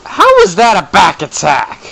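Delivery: mock indignant celebrity voice, drawn out; loud, slow impression of an outraged comedian; Bill Cosby. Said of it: "sounded like Bill Cosby".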